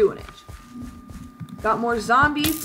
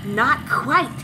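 throaty sinister taunt; creepy villain voice. Acted. Assassin revealing the Emerald Miner, creepy voice. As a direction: gloat in a put-on villain voice. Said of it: "I'm using my creepy voice for this".

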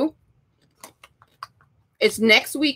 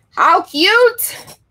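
delighted squeal; 9 semitones higher, 4 dB louder than usual. Real, not acted. Delighted exclamation.

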